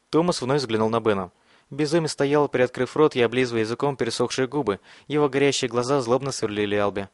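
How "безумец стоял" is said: ordinary delivery, nothing marked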